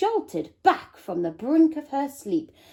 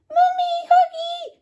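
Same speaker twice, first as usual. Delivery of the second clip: put-on very high, loud toddler wail; screaming baby; 7 dB louder than usual. A narrator's reading. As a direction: demand a cuddle like a clingy baby. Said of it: "screamed the baby".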